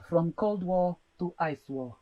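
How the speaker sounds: slow, low movie-trailer read; dramatic film trailer voice. Retold or imitated. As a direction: announce it like an epic film title. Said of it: "this is how he starts it".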